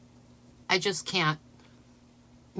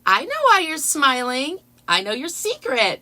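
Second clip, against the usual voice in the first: quoting her; 5 semitones higher, 7 dB louder than usual. Retold or imitated. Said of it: "she's like".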